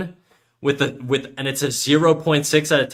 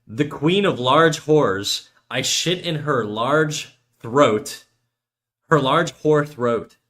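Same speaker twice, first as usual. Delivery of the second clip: slow, word by word; slow deliberate English translation; slow deadpan translation; 2 semitones higher, much slower than usual. Shown as a demonstration. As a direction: read it out slowly, deadpan. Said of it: "Let me translate that".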